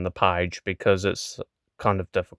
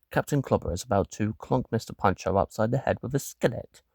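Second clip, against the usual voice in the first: normal talking voice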